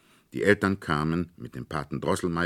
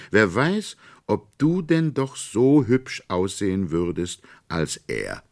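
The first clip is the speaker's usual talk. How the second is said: put-on high, louder girlish questioning; higher, louder child's challenge; earnest girl; 6 semitones higher, 6 dB louder than usual. A narrator's reading. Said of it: "sprach sehr ernst".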